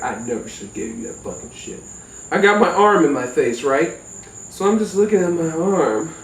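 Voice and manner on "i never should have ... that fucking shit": low, fast, under-the-breath regret; muttered regret. Retold or imitated. Cousin muttering regret over the stoned narrator. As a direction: mumble it to yourself in regret.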